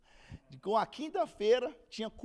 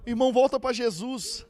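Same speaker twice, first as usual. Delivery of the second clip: loud, higher mock plea; mock calling out; 4 semitones higher, 11 dB louder than usual. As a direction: call out like a zealous church brother, in jest. Mock heckler calling someone to Jesus. (chamado em voz alta, imitando)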